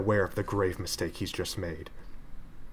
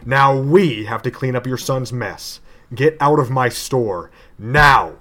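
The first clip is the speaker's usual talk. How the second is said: building to a furious shout; raging; 8 dB louder than usual. Acted. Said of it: "in a fit of panic and rage says".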